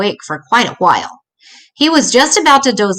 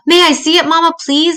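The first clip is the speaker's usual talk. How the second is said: eager plea; 9 semitones higher than usual. Acted. Brother begging to see movie.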